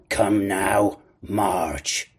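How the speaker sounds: cold command cutting in; cruel, cold. A narrator's reading. Blind man interrupting the boy's plea, cruel and cold. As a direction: order it with a touch of cruelty. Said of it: "a voice so cruel and cold and ugly".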